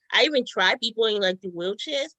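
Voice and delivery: ordinary talk, nothing marked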